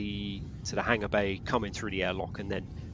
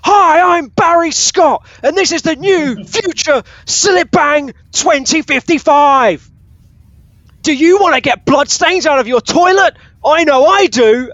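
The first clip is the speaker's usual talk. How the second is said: much higher, much louder hammed-up TV ad pitchman; blaring Barry Scott ad; 18 dB louder, slower than usual. Ad blaring at the players as a Barry Scott parody. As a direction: bellow it like an over-the-top cleaning advert. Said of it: "blaring at full sound".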